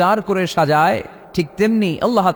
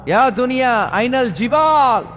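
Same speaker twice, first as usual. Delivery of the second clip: calling out; 7 semitones higher than usual. Acted. God calls out to the world.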